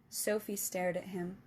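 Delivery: usual speaking voice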